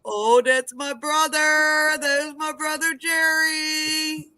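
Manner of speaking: high, slow, drawn-out call; gushing high hello; delighted greeting. Real, not acted. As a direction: announce a beloved brother proudly. Greeting brother joining the live.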